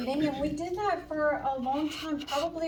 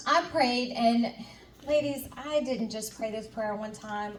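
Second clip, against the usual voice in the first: ordinary talk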